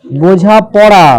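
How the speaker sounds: slow, drawn-out dictation of the word. Shown as a demonstration. Teacher giving the class a word to divide, slow dictation. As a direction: stretch each syllable out for writing.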